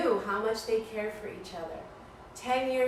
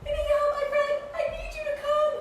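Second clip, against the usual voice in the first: high, loud, fast frantic pleading with a laugh; frantic yelling; 4 dB louder, faster than usual. Acted. Mouse begging elephants to help his friend, high frantic yell. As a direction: yell like a panicked little mouse. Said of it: "And he's yelling".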